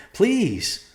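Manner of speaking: high cracking plea; desperate begging. A narrator's reading. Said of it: "she begged".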